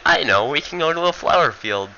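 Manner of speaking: a high squeal on the "Ah", then a sulky whine; lightly voiced, hammed-up reluctant fighter; whiny Furita. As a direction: play the coward who hates fighting. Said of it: "He's like".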